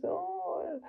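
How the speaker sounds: put-on aunt cooing over the helpful boy; pleased mother. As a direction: coo with false delight.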